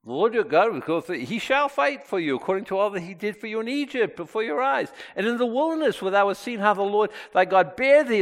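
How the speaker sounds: high-pitched, urgent pulpit reading; impassioned scripture preaching. Acted. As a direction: preach it with rising force and conviction. Preaches scripture at full force.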